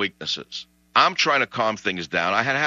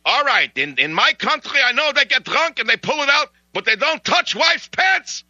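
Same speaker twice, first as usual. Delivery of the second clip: put-on high, louder Russian-accented rant; Russian man's voice; 13 semitones higher, 4 dB louder than usual. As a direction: voice the drunk Russian's outrage in his accent. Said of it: "It's like".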